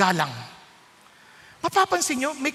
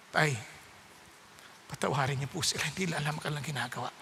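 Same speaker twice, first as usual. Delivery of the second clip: much softer, lower prayerful plea; hushed plea; 11 semitones lower, 12 dB quieter, faster than usual. Acted. Jesus on the cross asking his father softly to forgive. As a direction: murmur it like a dying man's prayer.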